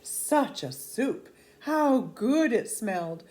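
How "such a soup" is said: ordinary delivery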